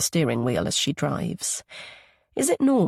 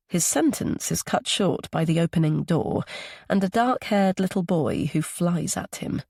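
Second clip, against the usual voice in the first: plain reading voice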